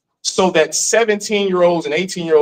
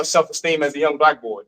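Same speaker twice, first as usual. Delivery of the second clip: normal talking voice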